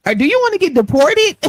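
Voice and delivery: high, loud mock tease; teasing friend. Friend teasing her about deportation, high mock jab.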